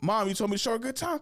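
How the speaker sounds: high, fast self-defence; imitated protesting son. Son defending himself to mom on the phone, high mock protest.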